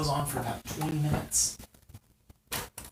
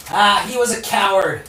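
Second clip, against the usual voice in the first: higher, loud sneering villain; Lanny taunting; 5 semitones higher, 8 dB louder than usual. Acted. Villain Lanny mocks the lone defender, loud and high. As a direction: scoff at the little man, loud and high. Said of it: "you hear Lanny say".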